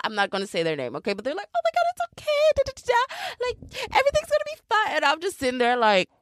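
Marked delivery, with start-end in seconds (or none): 1.6-5.0 squealed falsetto reassurance; hammed-up tearful comforting squeak; mock consoling. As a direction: imitate fussing girlfriends, very high and whiny. Mocking women consoling the crier.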